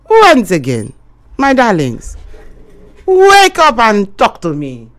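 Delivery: loud, drawn-out radio call to listeners; loud show-host call-out; rallying call-out. Real, not acted. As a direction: call out to wake your audience, stretching the words. Host calls listeners to phone in.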